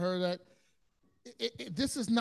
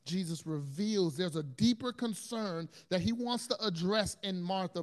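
usual speaking voice

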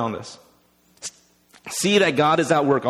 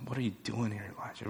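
low, very soft question; still small voice; 4 semitones lower, 14 dB quieter, faster than usual. Acted. God's voice questions Elijah at the cave, hushed. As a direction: barely voice it, fading on the name. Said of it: "the voice said to him".

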